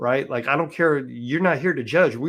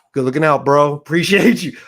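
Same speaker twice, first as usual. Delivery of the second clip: loud, raised-pitch thanks with a laugh; hearty thanks; 4 semitones higher, 9 dB louder than usual. Retold or imitated. Inmate thanking a porter for the tip-off, loud and laughing. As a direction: call out thanks, half laughing. Acted thanks for a riot warning.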